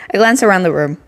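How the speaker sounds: ordinary talk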